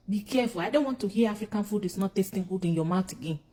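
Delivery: ordinary talk, nothing marked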